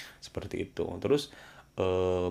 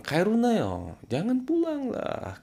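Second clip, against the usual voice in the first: high, sharp don't-go command; high stern prohibition; 3 dB louder, slower than usual. Shown as a demonstration. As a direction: forbid it sharply, pitch high. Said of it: "sambil nadanya tinggi". (larangan tegas dengan nada tinggi)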